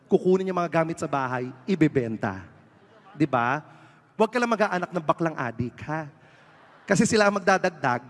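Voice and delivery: loud warning to the parents; comic sermon to the audience. Acted. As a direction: press the point hard and loud. Scolding crowd about drug addicts.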